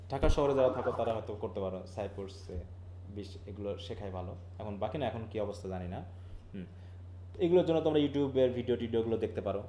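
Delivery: ordinary talk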